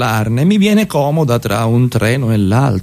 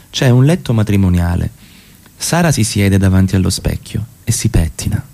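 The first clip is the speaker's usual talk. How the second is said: lower, even narrator voice reading the stage directions; 5 semitones lower than usual. A narrator's reading. Actor reads the scene directions between lines, in a lower, even narrator voice. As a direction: step out of the scene and read the directions calmly.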